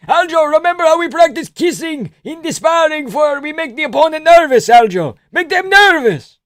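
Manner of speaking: put-on very high loud accented corner shouting; frantic high Georgian coach; Merab impression. Merab voiced corner advice.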